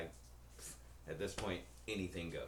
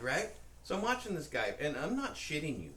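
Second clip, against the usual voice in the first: ordinary talk, nothing marked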